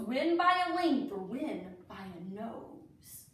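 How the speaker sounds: lilting rhyming storybook read; reading to children. Acted. Teacher reading a Derby alphabet book to children, storybook voice. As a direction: read each verse like a picture book for kids.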